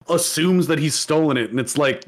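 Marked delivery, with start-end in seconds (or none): none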